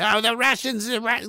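lightly voiced high mock panic; mock alarmist. Mocking the war crowd, a high mock whine. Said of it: "now they're like".

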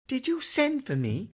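put-on high light child voice; small child. A narrator's reading. Son asks father if he sent for him, childlike. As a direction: ask sweetly like a little boy.

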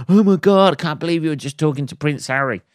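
squealed high and loud, then rushed; mock gushing friend. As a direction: play the starstruck friend, breathless and fast. Imagined friend recognising Harry.

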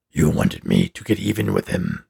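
low and gravelly, a simple creature; deep snowman voice. Acted. Snowman reminds the boy of his wish, deep gravelly voice. The living snowman answers Ralph.

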